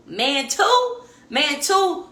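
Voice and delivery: shouted-up high repeat; 1 high exasperated repeat. Real, not acted. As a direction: stretch "man two" high, incredulous. Exasperated repetition of "man two.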